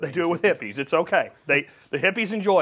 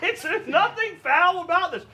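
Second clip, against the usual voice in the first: laughing; 11 semitones higher than usual. Real, not acted. Host still laughing.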